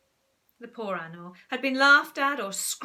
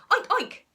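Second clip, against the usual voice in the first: high, loud oinks; zookeeper oinking; 11 semitones higher, 8 dB louder than usual. Acted. Zookeeper oinks to coax the shy frog out. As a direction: join in with bold pig noises. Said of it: "So Winston joined in".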